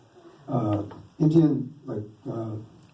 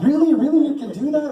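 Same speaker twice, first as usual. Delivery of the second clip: high, loud mock astonishment; imitating wide-eyed, incredulous children; amazed kids; 12 semitones higher, 4 dB louder than usual. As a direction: squeal the questions like impressed kids. Retold kids amazed by his skill.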